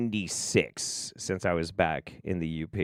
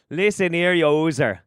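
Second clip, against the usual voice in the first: louder, higher Yooper scolding; Yooper accent; 5 semitones higher, 5 dB louder than usual. Acted. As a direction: tell them off like a Yooper. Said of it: "that Yooper accent a mile away".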